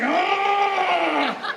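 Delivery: high, long-held mock cry; hammed-up climax shriek; imitated ecstatic scream. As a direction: wail out one long "ah". Imitating woman's sex cry.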